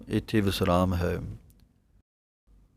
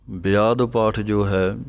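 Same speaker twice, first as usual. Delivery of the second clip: usual speaking voice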